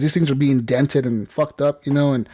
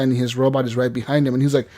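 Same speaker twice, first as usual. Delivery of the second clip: ordinary delivery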